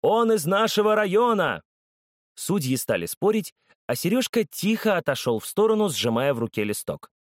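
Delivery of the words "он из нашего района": proud shout. A narrator's reading. Fan shouting at judges.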